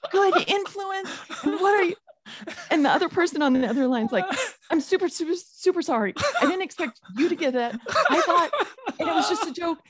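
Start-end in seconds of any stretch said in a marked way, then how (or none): 0.1-1.9 high, heated mock scolding mom; high-pitched Mama Bear scolding the laughing friend. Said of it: "here comes Mama Bear Swenson". 4.7-9.8 mock sheepish apology. Friend apologizing on phone.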